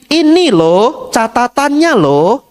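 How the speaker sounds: high, slow exasperated boss showing proof. Retold or imitated. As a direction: drag it out, fed up with the excuses. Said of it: "Sampai kasih bukti". (bos kesal, suara tinggi dan pelan)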